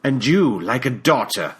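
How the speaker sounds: put-on hurt British accent; a betrayed mentor in a British accent; reproachful British accent. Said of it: "in that British accent of his".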